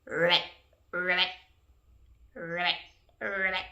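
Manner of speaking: low, rolled ribbits; short low trilled frog calls. Acted. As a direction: croak it like a frog. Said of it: "A frog says ribbit".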